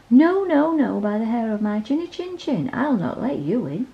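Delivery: a squeaky no, then stubborn; lightly voiced defiant piglet; little pig voice. A narrator's reading. Pig refuses the wolf.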